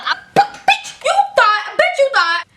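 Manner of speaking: high-pitched cocky squeal; gloating taunt. Retold or imitated. Crows at outwitting the teacher.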